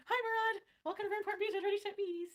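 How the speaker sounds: high, bright squeal of welcome to a viewer; excited greeting. Real, not acted. Greeting a viewer on live stream.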